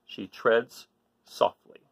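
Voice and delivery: usual speaking voice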